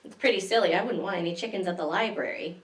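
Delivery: normal talking voice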